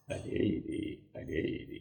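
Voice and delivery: soft secret whisper. Shown as a demonstration. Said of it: "రహస్యంగా చెప్పు".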